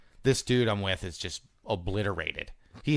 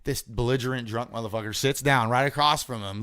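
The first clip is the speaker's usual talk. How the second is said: ordinary delivery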